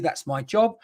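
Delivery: normal talking voice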